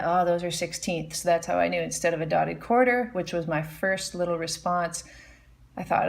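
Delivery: usual speaking voice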